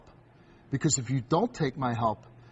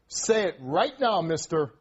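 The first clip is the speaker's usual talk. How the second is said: stern command; slower than usual. Real, not acted. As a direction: demand it like a fed-up authority, drawn out. Host orders boy to apologize.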